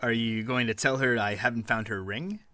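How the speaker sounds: normal talking voice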